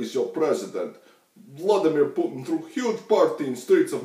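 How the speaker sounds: put-on loud, high-pitched comic Russian accent. Vlogger mocking a happy Russian guy for laughs, comic Russian accent. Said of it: "me doing a Russian accent".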